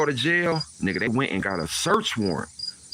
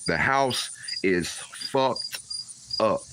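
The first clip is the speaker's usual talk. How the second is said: drawn out; much slower than usual. Retold or imitated. Slow heavy emphasis.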